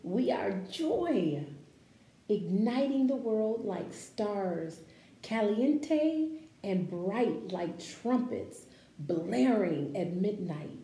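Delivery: slow, expressive picture-book read-aloud; reading to children. Acted. Teacher reading a picture book to her class, expressive read-aloud.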